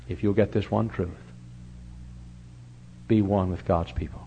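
usual speaking voice

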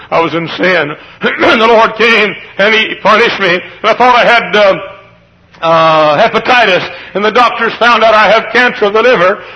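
high, loud preacher's retelling; anguished confession. Retold or imitated. Preacher retells a sick man's confession, anguished and loud.

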